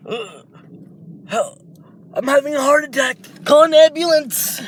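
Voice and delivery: gasping man calling out as his heart fails; panicked cry for help. Acted. Shizaki collapsing at dinner, high panicked shout for help. Character having heart attack.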